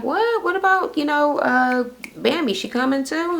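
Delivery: high-pitched startled questioning; mock surprise. Friends asking Scrappy about Bambi, mock surprised. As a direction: imitate taken-aback friends in a high voice.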